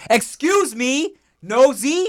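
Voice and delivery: high, put-on indignation; hammed-up, high-pitched scolding; indignant. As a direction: act affronted at nosy eavesdroppers. Acted snapping at eavesdropping bar patrons.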